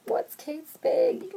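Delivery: high, slow, drawn-out clueless asking; clueless question. Retold or imitated. Wife asking her husband, high and slow in mock confusion. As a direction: play dumb about the brand, stretched slowly. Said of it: "and I was like".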